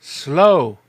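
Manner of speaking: high, loud repeated word; indignant ghost. Acted. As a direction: throw the word back in disbelief.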